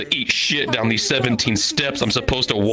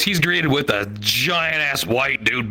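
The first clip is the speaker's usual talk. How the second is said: ordinary delivery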